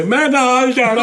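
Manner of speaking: high, slow pleading tree voice; a dying tree's high, slow plea; put-on tree voice. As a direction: beg to go along in a high, slow little voice.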